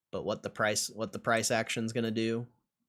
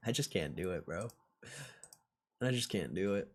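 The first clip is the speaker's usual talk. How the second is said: ordinary delivery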